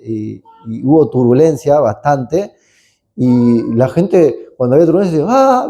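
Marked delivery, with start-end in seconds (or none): 5.3-5.7 high-pitched mock "aah" of panic; imitated crowd scream. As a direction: imitate frightened passengers without real volume. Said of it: "gritan todos juntos".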